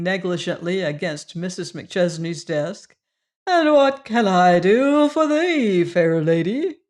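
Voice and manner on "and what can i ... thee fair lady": hammed-up courtly flourish, slow; bow with words like a stage cavalier; mock gallant. A narrator's reading. Fat man mock-gallant to boss.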